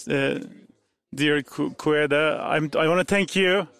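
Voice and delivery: ordinary talk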